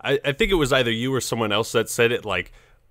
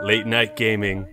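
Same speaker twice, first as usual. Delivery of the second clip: ordinary talk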